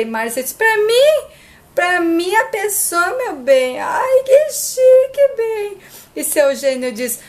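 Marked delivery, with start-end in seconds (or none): 0.5-3.8 high, thrilled, fluttery gushing; gushing over the flowers, high and thrilled; delighted squeal. Acted. Márcia flattered, showing off. 3.8-5.4 very high, slow, stretched-out swoon; Márcia swooning over the roses, high and slow; drawn-out high swoon. Acted. 5.4-5.8 high, thrilled, fluttery gushing; gushing over the flowers, high and thrilled; delighted squeal. Acted. Márcia flattered, showing off.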